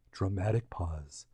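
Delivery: very low, hushed and slow; slow, quiet stage direction; mock-dramatic aside. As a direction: drop to a near murmur for suspense. Announces a dramatic pause, aside.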